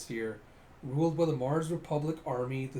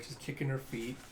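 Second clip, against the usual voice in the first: normal talking voice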